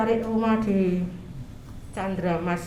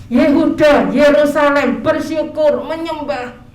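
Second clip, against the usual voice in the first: proclaiming shout; 4 semitones higher, 8 dB louder, slower than usual. Acted. Proclaims verse to congregation.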